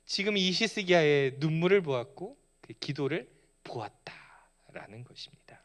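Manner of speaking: breathy whispered emphasis; preacher whispering to the congregation. Real, not acted. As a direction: lean in and whisper the key truth. Dramatic hushed point in sermon.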